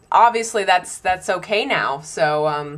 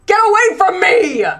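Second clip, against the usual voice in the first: much higher, loud recoiling shout; 10 semitones higher, 7 dB louder than usual. Retold or imitated. Snapping at a stranger standing too close. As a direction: yell at the stranger to back off.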